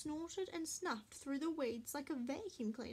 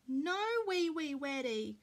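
put-on baby yelling; 6 dB louder than usual. A narrator's reading. Baby Louie spots Marley at the litter tray, yelling. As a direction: yell it like an outraged little child. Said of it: "Baby Louie yelled".

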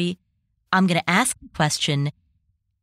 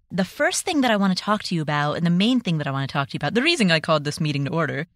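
nervous, hurried rush of words; speaking really quickly; faster than usual. Real, not acted. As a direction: rush through it out of nerves. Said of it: "I'm speaking really quickly".

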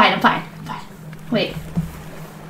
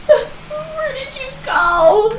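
tearful wail; 11 semitones higher than usual. Acted. Mother searching for Strawberryna, tearful wail. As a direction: wail in hammed-up grief. Mourns toy lost at sea.

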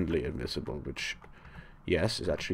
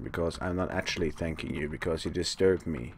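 usual speaking voice

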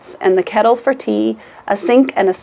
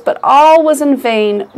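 ordinary delivery, nothing marked

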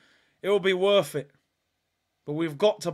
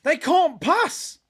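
higher, slow and drawn out; incredulous moan; 8 semitones higher, 3 dB louder, slower than usual. Real, not acted. Fan groaning at his team's passing. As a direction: say it like you can't believe it. Disbelief repeated.

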